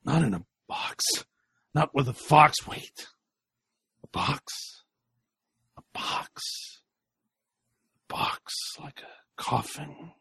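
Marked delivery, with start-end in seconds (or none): 2.7-10.1 slow, hushed whisper; whispered realization. Acted. Sam realizing in a whisper. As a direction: breathe it as it dawns.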